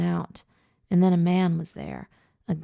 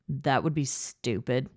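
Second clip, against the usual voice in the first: put-on low, slurred drunken retort; 3 semitones lower than usual. A narrator's reading. Drunk Jason shooting down the suggestion, slurred. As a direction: slur it like a tipsy man. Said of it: "Jason slurred".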